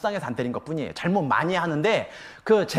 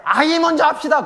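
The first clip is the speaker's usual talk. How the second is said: loud, high call over the chaos; urgent shout on a sinking ship; 11 semitones higher, 8 dB louder than usual. Retold or imitated. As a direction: yell it to the crowd on the ship.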